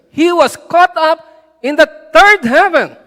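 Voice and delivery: forceful, punched-out delivery; emphatic preaching climax. Real, not acted. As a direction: proclaim it to the whole church. Preacher's climax on third heaven.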